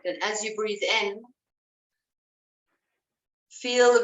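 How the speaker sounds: calm, unhurried guiding voice; breath coach easing the class into awareness; guided breathing. Acted. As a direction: lead them gently into noticing the breath.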